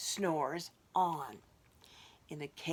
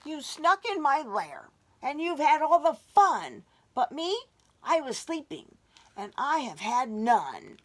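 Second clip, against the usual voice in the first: loud, higher whiny bear complaint; sulking bear; 6 semitones higher, 7 dB louder than usual. Acted. Bear complaining to party guests, loud whiny voice. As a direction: complain loudly like a left-out bear.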